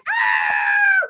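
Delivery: high pretend scream; piercing high squeal; princess screaming. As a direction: shriek like the pictured princess. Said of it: "she's going".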